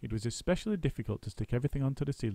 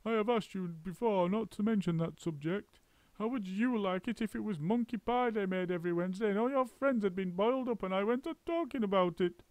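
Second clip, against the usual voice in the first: high, reproachful bird voice; a hurt friend scolding; high injured bird; offended bird; 10 semitones higher than usual. A narrator's reading. Roly-poly bird's complaint.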